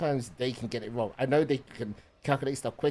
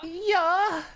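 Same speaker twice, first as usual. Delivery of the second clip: high loud German "ja"; German accent; 6 dB louder than usual. Retold or imitated. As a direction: bark a German "ja". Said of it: "That is a German".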